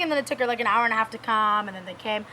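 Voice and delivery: usual speaking voice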